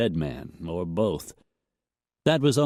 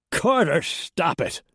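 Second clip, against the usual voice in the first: desperate shout; 9 semitones higher, slower than usual. A narrator's reading. The rancher desperately orders his gunman to stop, shouting. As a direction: lash out in desperation. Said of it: "held a note of desperation".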